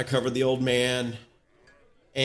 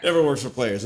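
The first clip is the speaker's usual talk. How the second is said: ordinary talk, nothing marked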